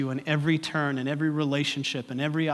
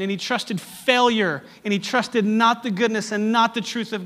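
higher and louder, preaching at full force; fervent preaching; 6 semitones higher, 6 dB louder than usual. Acted. Pastor urging the congregation to trust God, fervent preaching. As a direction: drive it home to the congregation.

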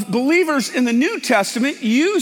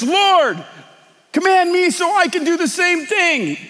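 eager call; 6 semitones higher than usual. Retold or imitated. Peter calling eagerly to Jesus.

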